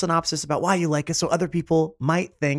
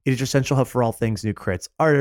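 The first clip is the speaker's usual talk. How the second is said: normal talking voice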